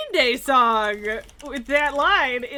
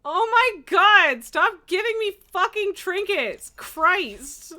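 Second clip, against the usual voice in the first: higher, louder annoyed outburst; exasperated; 7 semitones higher, 5 dB louder, slower than usual. Real, not acted. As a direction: vent at the game loot. Frustrated at game loot.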